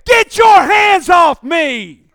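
God roaring at the man, slow and loud; furious shout. Retold or imitated. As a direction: roar it slowly, each word hit.

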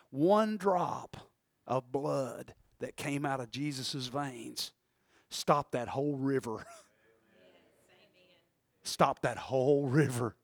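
low, soft, slow emphasis; grave, quiet awe; hushed solemn preaching. Real, not acted. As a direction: drop to near-murmur and let it hang. Preacher dwells solemnly on the blood.

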